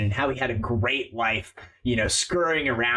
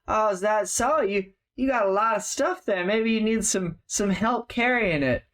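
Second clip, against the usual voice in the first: higher, mock friendly menacing thug; sly thug; 9 semitones higher than usual. GM voicing the thug eyeing the cart, mock friendly. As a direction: fake helpful, eyeing the loot.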